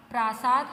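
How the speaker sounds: "प्रासाद" with long first a, PRAA-saad; loud "प्रासाद", stretching the first vowel; misspelt option. Shown as a demonstration. Teacher reads out another option, "प्रासाद" with a long first vowel. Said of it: "इसमें से correct कौन सा है".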